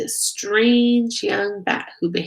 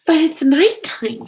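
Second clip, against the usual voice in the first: high, louder little squeak; 8 semitones higher, 7 dB louder than usual. A narrator's reading. Stella Luna squeaks to her mother, worried. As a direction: squeak it out nervously. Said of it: "Stella Luna squeaked".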